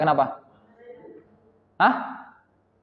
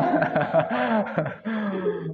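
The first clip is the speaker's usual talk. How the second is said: soft, breathy chuckle; amused little laugh after a gag; 7 dB quieter than usual. Real, not acted. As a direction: laugh quietly at the joke. Teacher laughs at own joke.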